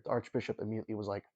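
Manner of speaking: normal talking voice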